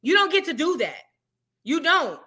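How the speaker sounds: ordinary talk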